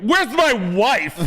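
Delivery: high, slow put-on realtor voice; a drawn-out, high prissy realtor call; realtor impression. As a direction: whine it like the fussy realtor. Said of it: "my brother and I was like".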